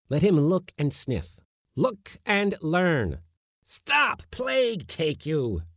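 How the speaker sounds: high, slow then sharp angry shout; shouting schoolmaster. A narrator's reading. Uncle shouting at the retreating kitten, high. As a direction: bark a lesson then lose patience. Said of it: "he shouted".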